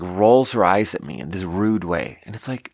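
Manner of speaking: ordinary talk, nothing marked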